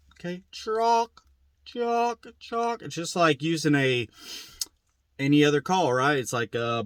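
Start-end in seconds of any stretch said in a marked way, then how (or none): 0.5-2.8 high, spaced-out "chalk" calls; mimicked turkey yelp. Shown as a demonstration. Teacher breaking down the yelp, high slow turkey mimicry. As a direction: draw out each "chalk" high like a bird call. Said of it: "that's how a yelp is made".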